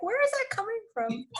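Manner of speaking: baffled mom reacting to a toddler's drama; mock astonishment. Mother to her toddler daughter, amused disbelief. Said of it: "I'm like".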